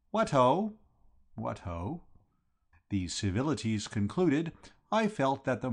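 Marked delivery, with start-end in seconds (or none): 0.1-0.8 bright, loud, high "What ho?"; breezy cheerful hail; hearty greeting. Acted. Bertie greets Gussie heartily. 1.3-2.0 "What ho?" softer, level and listless; limp, deflated reply; dejected echo. Acted. Gussie answers dejectedly.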